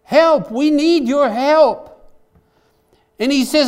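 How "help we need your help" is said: high, loud, slowing plea for help; crying out to God. Acted. Preacher acts the prophet calling on God, high loud begging cry. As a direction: call up to heaven, begging. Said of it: "he's crying out to God".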